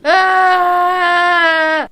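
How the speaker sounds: loud sudden yell of alarm; real shout of surprise; startled yell. Real, not acted. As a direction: yell as the car goes over. Car crashes after failed turn.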